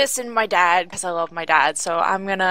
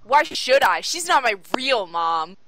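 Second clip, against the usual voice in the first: put-on raised, defiant teenage comeback; petulant retort; 3 semitones higher, slower than usual. Daughter rejecting stepmother in quarrel with dad, petulant retort. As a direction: throw it back at him, pitched up.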